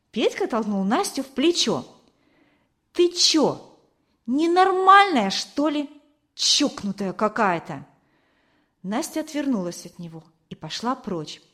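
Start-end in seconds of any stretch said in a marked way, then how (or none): 2.9-7.9 high-pitched insult, dropping at the end; scornful outburst that falls away; rude jeering. A narrator's reading. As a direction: jeer at her as if she is crazy. Boy shoves and insults girl.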